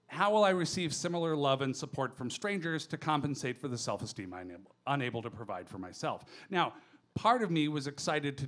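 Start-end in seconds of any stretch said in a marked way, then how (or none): none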